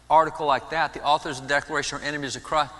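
ordinary talk, nothing marked

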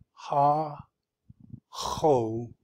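"Ha" apart, then "Choshek" with throaty Ch; H, hard H. Shown as a demonstration. Teacher breaking down the word, hard H in "Choshek". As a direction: split "Ha Choshek" to contrast plain and hard H. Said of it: "H and then the hard H".

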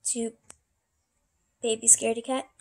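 usual speaking voice

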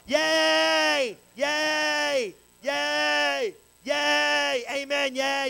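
high, drawn-out mock yays; mock excited cheering. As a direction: cheer like an overexcited congregation, hammed up. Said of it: "get all excited about the promise".

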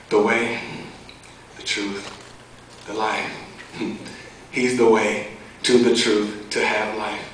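measured, rhythmic testimony poem; earnest spoken-word delivery with deliberate pauses. Acted. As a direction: recite it like poetry, line by line.

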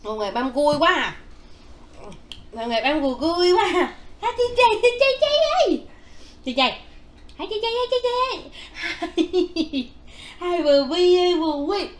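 high, loud, excited calling. Real, not acted.

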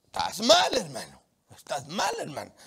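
half-hearted blunt telling-off; a preacher acting out scolding a brother; blunt call-out. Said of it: "uno no puede decir".

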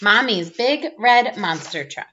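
ordinary delivery

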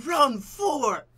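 high, loud gasp then cry; startled, half-crying announcement; gasp of shock. Real, not acted. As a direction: dread the new round. Shocked at new round.

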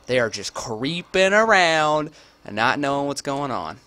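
loud, slow mocking taunt; sarcastic loud taunt at the sneaking players; mocking sneakers. Real, not acted. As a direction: jeer at them as they creep around. Taunting sneaking enemies aloud.

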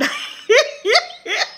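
high bright laugh bursting out; real burst of laughter. Real, not acted. Laughing at Ty's comment.

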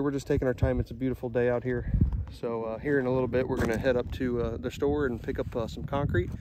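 ordinary talk

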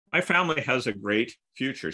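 ordinary talk

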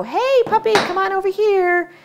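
high coaxing call to a puppy; sweet puppy talk. Retold or imitated. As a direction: coo at the dog like a doting owner. Owner rewarding a barking dog.

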